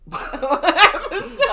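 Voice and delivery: laughing through the word, slow and loud. Real, not acted. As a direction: let the laugh drag the word out. Laughing at own joke.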